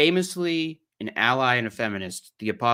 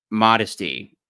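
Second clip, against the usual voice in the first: normal talking voice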